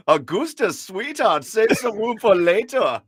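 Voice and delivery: high, slow hammed-up German sergeant voice; Sergeant Schultz impression. Host imitating Sergeant Schultz speaking to Augusta. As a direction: ham up the TV sergeant talking to his sweetheart. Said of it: "Sergeant Schultz from Hogan's Heroes".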